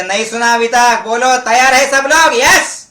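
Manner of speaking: building to a high shout; loud rallying. Shown as a demonstration. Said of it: "आवाज पाहिजे". (जोशात, चढत्या आवाजात)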